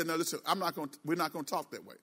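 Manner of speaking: low, soft, restrained boundary-setting; demonstrating a quiet, controlled reply; calm refusal. Shown as a demonstration. Models calm refusal to an abuser.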